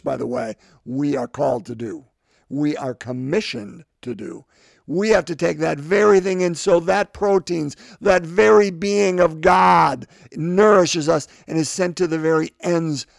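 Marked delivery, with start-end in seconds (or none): 4.9-13.1 loud, higher, slowing for emphasis; forceful preaching. Real, not acted. Preacher urging congregation to take God in, loud and impassioned.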